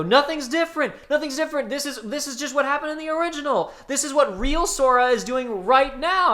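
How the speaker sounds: high, laughing imitation of a friend in disbelief; laughing mock disbelief. Imitating Joey's high laughing outburst at the unchanged level. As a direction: mimic him cracking up in a high voice. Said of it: "you start laughing your ass off and go".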